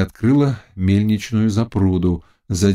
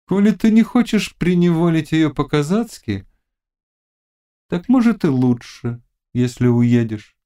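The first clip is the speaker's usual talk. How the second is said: higher-pitched witch giving blunt advice; witch Gorpyna, coaxing; 8 semitones higher than usual. A narrator's reading. Witch advising the Cossack about his captive, coaxing.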